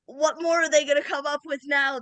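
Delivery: loud, high, amazed outburst; excited. Real, not acted. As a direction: blurt it out in disbelief. Amazed at modern tech.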